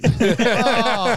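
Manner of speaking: high, loud laughing "oh". Retold or imitated. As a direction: laugh out a surprised oh. Laughing reaction to friend's request.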